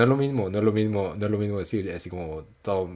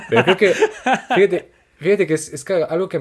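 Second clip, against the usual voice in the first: laughing; 12 semitones higher, 11 dB louder than usual. Real, not acted. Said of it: "laughing while talking".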